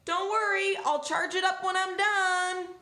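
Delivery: cheerfully oblivious high donkey shout; donkey calling out. Acted. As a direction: call it out in a high-pitched character voice. Donkey calls out to departing owner.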